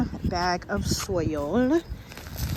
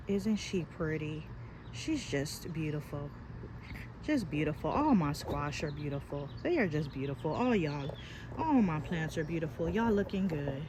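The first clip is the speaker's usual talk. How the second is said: softer, lower cooing over her plants; tender cooing; 4 semitones lower, 10 dB quieter than usual. Real, not acted. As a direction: coo at the squash like a proud mother. Cooing over her beautiful squash.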